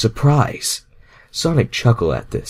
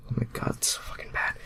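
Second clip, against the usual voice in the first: whisper; 14 dB quieter, much faster than usual. Real, not acted. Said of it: "whispered aside".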